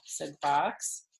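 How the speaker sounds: ordinary delivery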